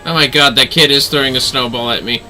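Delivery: ordinary talk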